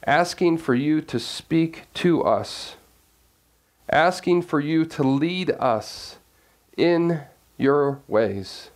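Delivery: low, unhurried prayer voice. Real, not acted. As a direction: reverent opening prayer. Opening prayer before sermon.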